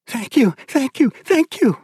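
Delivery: high, breathless thanks through happy tears; overjoyed wife thanking her husband through tears; tearful joy. Acted. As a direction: gush thank you like she's laughing and crying. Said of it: "laughter melted into tears".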